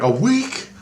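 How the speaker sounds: outraged surprise at the delay; incredulous. Retold or imitated. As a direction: shoot way up in shocked disbelief. Shocked at waiting a week.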